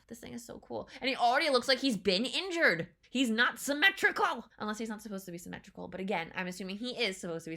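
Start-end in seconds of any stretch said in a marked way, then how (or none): 1.0-4.4 loud, higher, indignant protest; loud upset fan reaction; indignant outburst. Real, not acted. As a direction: raise your voice in protest at an injury. Upset that creature looks injured.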